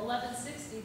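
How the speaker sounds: normal talking voice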